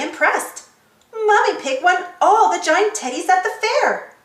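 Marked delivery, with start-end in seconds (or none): none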